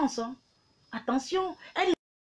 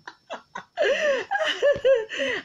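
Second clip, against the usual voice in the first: high shrieking burst of laughter. Real, not acted. Laughing at her live audience with a high shriek. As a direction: let a real high-pitched cackle rip.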